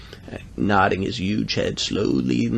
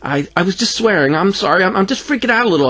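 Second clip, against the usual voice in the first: high, stumbling blurt; 8 semitones higher than usual. A narrator's reading. Boy admits to swearing, high hurried blurt. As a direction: rush out a flustered apology. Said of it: "I blurted".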